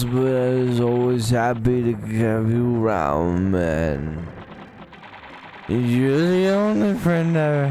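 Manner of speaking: put-on slow, sloppy sentimental drunk; slurring a teary thank-you at the bar; drunk in a tavern. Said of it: "I sound like a fucking drunk in a tavern".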